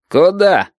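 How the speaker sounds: high, abrupt sentry challenge; barracks tone. A narrator's reading. Sentry stops travellers at the barrier, barracks tone. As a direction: challenge them like a barracks guard. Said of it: "в ответ на казарменный тон".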